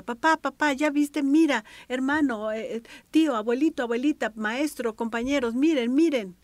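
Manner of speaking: put-on high, loud pleading child voice; a child pulling at grown-ups for praise; child calling out. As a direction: beg every adult to look at you. Child begging everyone to look.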